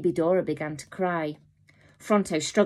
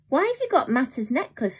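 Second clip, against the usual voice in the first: surprised; 8 semitones higher than usual. A narrator's reading. Boy shocked.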